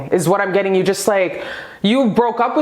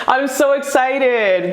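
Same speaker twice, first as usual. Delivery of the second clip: slow, higher giddy delivery; excited, laughing; 4 semitones higher, slower than usual. Real, not acted. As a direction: stretch it out happily through a laugh.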